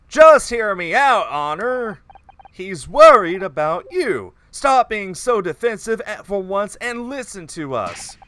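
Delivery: hammy, loud melodramatic pleading; lightly voiced bad actor. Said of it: "this bad actor voice".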